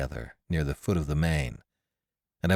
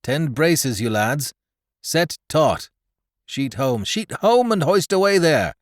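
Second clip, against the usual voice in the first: loud, high bosun's commands; a sailor calling commands into the wind; shouted sea orders; 8 semitones higher, 5 dB louder than usual. Acted. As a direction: bark orders across the deck. Shouted ship orders on deck.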